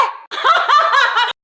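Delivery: high, loud mad laugh; crazed cackle. Acted. Hostage taker mocking her enemies, crazed cackle. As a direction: burst into a manic cackle.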